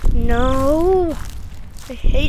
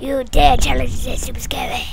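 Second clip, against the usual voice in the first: whisper. Pretend. Whispered villain mock line.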